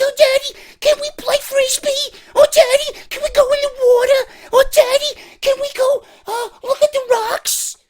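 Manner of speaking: put-on loud, very high squeaky child voice; nagging kids. Kids pestering daddy at the beach, squeaky nagging. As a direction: pester in a piercing falsetto. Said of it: "and you got the kids".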